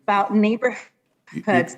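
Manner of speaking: choppy, cutting out; bad connection audio; radio breaking up. Real, not acted. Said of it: "your voice is going in and out".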